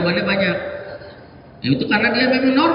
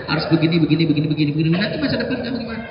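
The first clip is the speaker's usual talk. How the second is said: lower, softer mock nagging, fast in places; nagging mother; 5 semitones lower, 6 dB quieter, much faster than usual. Mother lecturing her teenager every day, mild mock nagging. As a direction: nag the teen on and on. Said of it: "Setiap hari tanya".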